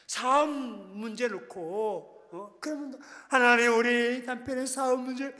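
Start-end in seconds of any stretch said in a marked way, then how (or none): 3.3-5.3 loud, high, drawn-out tearful cry; weeping prayer. Shown as a demonstration. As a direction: wail to God over husband's business. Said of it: "울면 되는 거야". (남편 사업 문제로 울며 부르짖는 기도)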